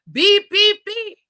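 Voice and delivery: high, loud beep beep; imitating truck beeps. Retelling the truck warning beeping on the drive, high loud beeps.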